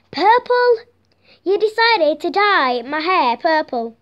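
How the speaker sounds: loud, high disbelief on the colour; hammed-up indignant surprise; mock outrage. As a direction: play a shocked salon customer. Customer appalled at purple hair.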